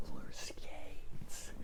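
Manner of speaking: slow, barely voiced mouthing; mouthed whisper. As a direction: stretch each word silently.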